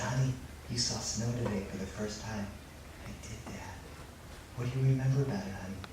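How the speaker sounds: low, gentle, near-whispered exchange; hushed, tender. Acted. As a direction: talk quietly with a sleepy child. Father and sleepy son at night.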